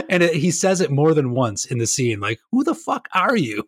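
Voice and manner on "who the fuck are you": high, incredulous mock question; the same bewildered guy, pitched way up; baffled dude. Imitating the dude's disbelief for his cohost, high mock voice. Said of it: "And he's like".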